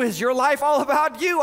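ordinary talk

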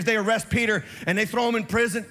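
usual speaking voice

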